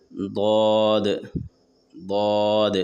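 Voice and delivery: ضاد said thick and heavy, not زواد or دواد; emphatic ض in ضاد; heavy daad. Shown as a demonstration. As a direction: give ضاد a full, heavy sound. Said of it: "لیکن موٹا پڑھنا ہے".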